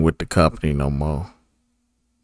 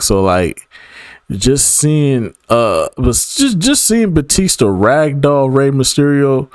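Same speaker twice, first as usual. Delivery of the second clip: normal talking voice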